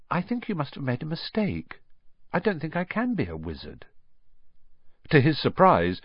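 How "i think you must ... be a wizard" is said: put-on softly unsure boy; quiet. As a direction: say it quietly, doubting. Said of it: "he said quietly".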